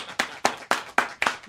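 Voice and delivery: breathy laughing burst; loud laugh. Real, not acted. Laughs after announcing.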